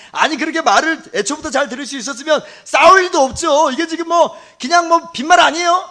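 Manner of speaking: a doubter scoffing loudly; sceptical objection. Pretend. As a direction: challenge the preacher as if it's all empty talk. Voicing a sceptical listener's objection.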